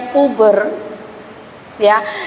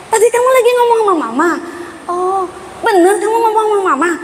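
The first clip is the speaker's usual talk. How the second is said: higher, quick incredulous questions; mock surprise; 6 semitones higher, faster than usual. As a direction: feign gentle astonishment. Mama menegaskan dengan heran. (mama pura-pura heran pada anak)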